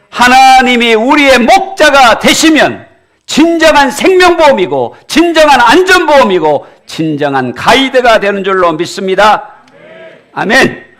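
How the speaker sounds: loud, high, ringing sermon voice; fiery preacher calling out; preaching at full force. Acted. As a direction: drive it home to the congregation.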